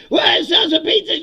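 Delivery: mock rally yell, high and very loud; hyped convention shout. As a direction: whip up a sales crowd. Mimicking pizza convention hype crowd.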